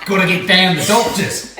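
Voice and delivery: broad Aussie character line; Australian accent. Shown as a demonstration. Giving Mum a line to copy, Australian accent. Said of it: "trying to do an Australian accent".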